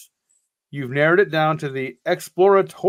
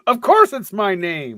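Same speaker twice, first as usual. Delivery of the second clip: indignant; 5 semitones higher, 5 dB louder than usual. Acted. Indignant retort.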